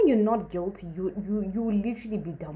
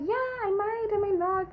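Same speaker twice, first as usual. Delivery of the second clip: coy tease. Retold or imitated. Teasing the guy about clubbing.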